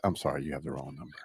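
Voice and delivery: lightly voiced flat phone answer; low, quiet mock brush-off; deadpan wrong number. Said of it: "I'm gonna call Mark and be like".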